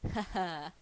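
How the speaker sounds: laughing at the joke; real giggle. Real, not acted. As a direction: burst of amused laughter. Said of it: "I get the reference".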